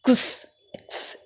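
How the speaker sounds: क्स as a soft breathed k-s with no vowel; ending sound demo. Shown as a demonstration. Teacher isolates the ending sound for pupils, soft k-s. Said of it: "क्या sound होती है इसकी?".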